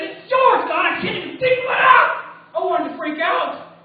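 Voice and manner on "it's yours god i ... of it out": pitched high and louder, handing it to God; high surrender prayer. Retold or imitated. Preacher retelling his prayer at the DMV, high and loud. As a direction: cry it up to God, raised and pleading. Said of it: "I just sat there and I said".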